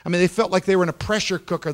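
ordinary delivery, nothing marked

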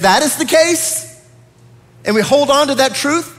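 preacher building in pitch to incredulous questions; emphatic preaching. Real, not acted. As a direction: drive each phrase home, rising into a challenge. Preaches with rising force.

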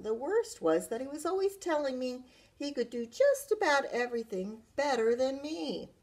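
usual speaking voice

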